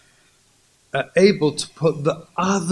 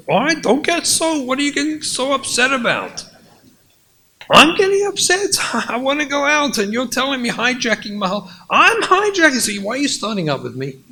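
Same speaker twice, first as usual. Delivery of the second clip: upset husband; 10 semitones higher, faster than usual. Acted. Husband snapping back at his wife, high and exasperated. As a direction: flare up, defensive and aggrieved.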